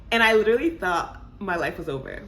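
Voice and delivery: normal talking voice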